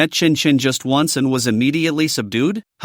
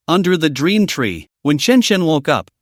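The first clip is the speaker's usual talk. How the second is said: ordinary delivery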